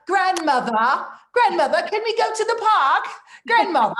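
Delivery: put-on high, loud child calling. Grandson calling his grandmother, high and loud. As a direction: play the eager grandson. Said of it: "he's gonna be like".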